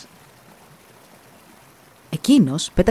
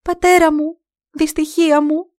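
very high, loud anguished calling; crying and calling; 9 semitones higher, 5 dB louder than usual. Acted. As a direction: wail for the lost father. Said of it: "κλαίγοντας και φωνάζοντας".

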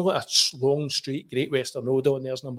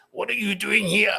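high, mock-stern challenge; imitating security guard; 8 semitones higher, 3 dB louder than usual. Private security confronting the journalist, high imitated challenge. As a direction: challenge an intruder in a raised-pitch put-on voice.

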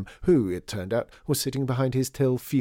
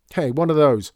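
sharp loud higher shout; 5 semitones higher, 10 dB louder than usual. A narrator's reading. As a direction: yell to stop her. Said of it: "shouted John".

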